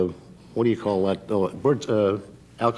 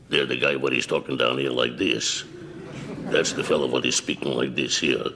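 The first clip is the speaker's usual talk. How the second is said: Yiddish dialect; 8 semitones lower than usual. Shown as a demonstration. Voice actor shows the interviewer his dialects, low Yiddish accent. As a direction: talk down low like a Yiddish fellow. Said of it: "Take a Yiddish dialect, for example".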